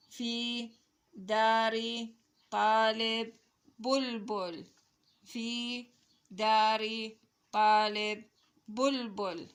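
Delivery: measured, word-by-word reading; slow model reading. Shown as a demonstration. Teacher reading a sentence to children, slow model reading. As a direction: hold the long vowels, pause between words.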